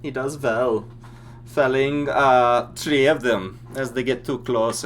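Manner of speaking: GM voicing a German soldier with accent; thick Germanic accent. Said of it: "like a French-German bastard".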